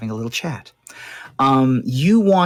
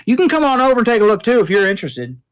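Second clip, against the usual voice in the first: higher, quicker mechanic voice; quick, pitched-up offhand invitation; tinkerer Hank; 10 semitones higher, 4 dB louder, much faster than usual. Acted. Mechanic invites the party to look through his parts, higher and quick.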